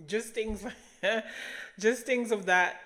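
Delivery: amused giggling about healthy food; laughing. Real, not acted. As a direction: laugh at your own list. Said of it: "laughs at herself".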